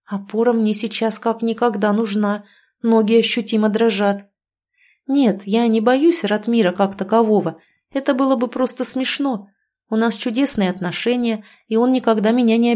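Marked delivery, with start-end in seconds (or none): none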